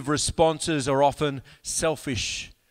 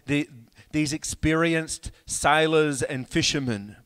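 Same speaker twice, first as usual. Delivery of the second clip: ordinary talk, nothing marked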